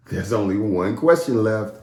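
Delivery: plain reading voice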